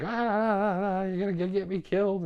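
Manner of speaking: high, fast whiny voice of a panicking friend; panicked Jeff. Retold or imitated. Friend Jeff blaming the hiker for nearly getting him killed, high panicked whine. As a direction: squeal it like a scared buddy blaming you.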